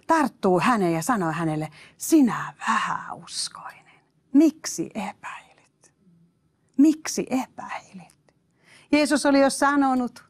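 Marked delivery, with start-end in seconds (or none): 2.0-8.3 slow hushed whisper of a rebuke; Jesus gently rebuking Peter in a slow whisper. Acted. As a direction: whisper it slowly like a gentle reproach.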